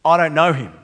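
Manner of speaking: loud, clipped-off denial; emphatic denial. Retold or imitated. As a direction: slam the door on him. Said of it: "emphatically denies".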